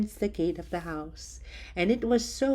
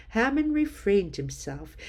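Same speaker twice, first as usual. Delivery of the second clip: ordinary delivery, nothing marked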